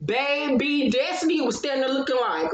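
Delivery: ordinary talk, nothing marked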